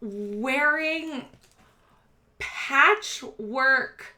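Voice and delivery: slow, deliberate scene setting; game master description. A narrator's reading. Game master describing a gaudy rat boy, slow measured narration. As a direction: lay out each detail slowly, with pauses.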